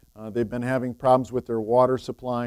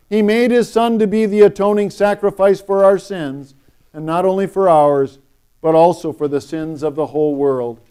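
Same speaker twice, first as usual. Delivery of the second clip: liturgical reading; 4 semitones higher, 9 dB louder than usual. Acted. Reads the liturgy.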